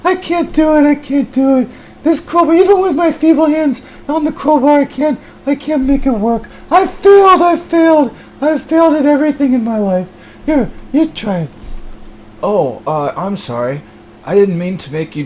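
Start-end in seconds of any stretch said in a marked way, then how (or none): 0.0-11.5 high, whining loser voice; whiny high character. Acted. High whiny voice bemoaning the crowbar. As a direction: whine it like a hopeless failure.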